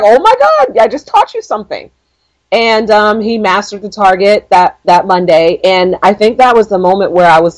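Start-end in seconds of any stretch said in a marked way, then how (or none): 0.0-1.9 high, thrilled squeal; excited squeal. Retold or imitated. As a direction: squeal with delight at the breakthrough. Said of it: "And I was like".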